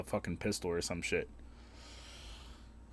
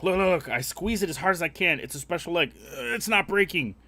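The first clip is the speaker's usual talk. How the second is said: put-on high, louder eager kid showing off; kid's voice; 7 semitones higher, 5 dB louder than usual. Neighbor kid showing off his unbreakable egg, higher and louder. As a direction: brag like a boy with a toy. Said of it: "he's like".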